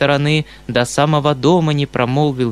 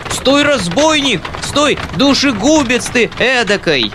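very high, loud wail; desperate yell; 10 semitones higher, 4 dB louder than usual. Acted. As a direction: scream after the carriage in despair. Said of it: "отчаянный вопль".

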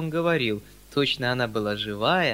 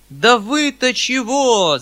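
высокий, громкий, растянутый окрик; крикни мужикам в запальчивости; запальчивый крик; 10 semitones higher, 10 dB louder, much slower than usual. A narrator's reading. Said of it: "закричал я в запальчивости". (запальчивый окрик)

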